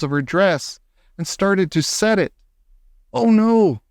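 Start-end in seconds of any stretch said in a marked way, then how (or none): 3.1-3.8 dismayed. Acted. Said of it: "I thought".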